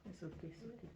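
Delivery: fading to a hushed, repeated murmur; soft soothing. Real, not acted. Mother lulling her son back to sleep, fading murmur.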